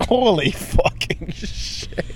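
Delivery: hushed slow whisper of horror; whispered shock. Retold or imitated. Lads at the table whisper at the ghoul, stunned. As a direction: breathe it out in horror, slowly. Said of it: "They're like".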